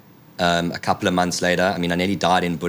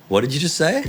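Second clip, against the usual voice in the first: high-pitched mock disbelief; acted-out stunned query; incredulous question; 6 semitones higher than usual. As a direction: ask it high and baffled. Acted disbelief at doctor's news.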